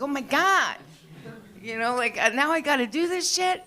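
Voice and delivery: high, put-upon whine; exasperated. Retold or imitated. Comic dreading web work, high mock-exasperated whine. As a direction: pitch up in mock despair.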